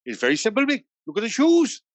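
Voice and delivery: high, hammed-up Merthyr Tydfil lilt; bad Merthyr Tydfil accent. Club manager explaining his door policy, in a rough Merthyr Tydfil accent. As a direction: imitate a Welshman from Merthyr Tydfil, pitch jumping up. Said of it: "that's a terrible Merthyr Tydfil accent".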